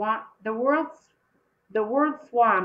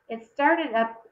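usual speaking voice

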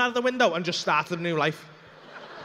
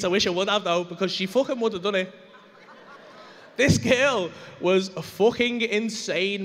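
ordinary talk

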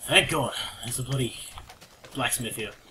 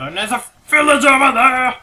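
excited shout; 12 semitones higher, 15 dB louder than usual. Real, not acted. Spots a village, calls out.

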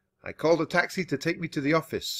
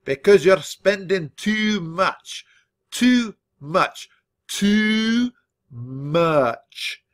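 raised, insistent scolding with drawn-out endings; bellowed rant; 5 semitones higher, 4 dB louder, slower than usual. A narrator's reading. Grandfather lectures on overspending, bellowed rant. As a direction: hammer each repeat, stretching the last one.

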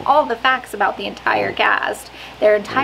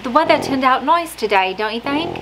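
higher, sing-song attempt at Australian, lifting at the end; amateur put-on Australian accent; failed Australian accent; 3 semitones higher than usual. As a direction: try an Australian accent and not quite land it. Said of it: "definitely not an Australian accent".

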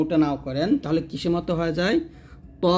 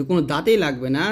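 ordinary talk

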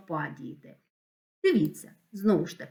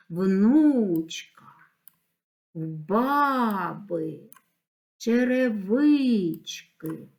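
drawn-out stressed vowels in "Внучка", "баби", "Черевички": у, first а, и of ви; demonstrating the stress; much slower than usual. Shown as a demonstration. Teacher marking stresses, "Внучка", "баби", "Черевички" with long stressed вну, ба, ви. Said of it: "Треба розставити наголоси".

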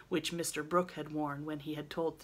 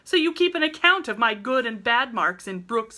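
indignant outburst; 7 semitones higher, 12 dB louder than usual. Acted. Laurie indignant, much louder.

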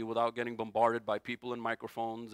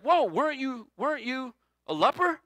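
much higher and louder, an incredulous "whoa"; mock astonishment; 10 semitones higher, 6 dB louder than usual. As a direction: play the startled townsfolk recognizing the man. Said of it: "and everybody's like".

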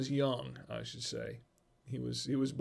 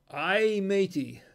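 hearty pirate growl, a bit higher; 5 semitones higher, 4 dB louder, slower than usual. Retold or imitated. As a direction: ham it up like an old pirate. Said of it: "what did the pirate say".

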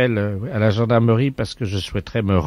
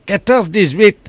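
hammed-up mock insistence; 11 semitones higher, 8 dB louder than usual. Prank caller ends call.

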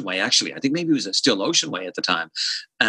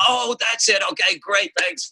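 imitating Mike; 11 semitones higher than usual. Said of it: "And I'm like".

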